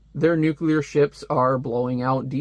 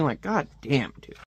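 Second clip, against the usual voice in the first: ordinary talk, nothing marked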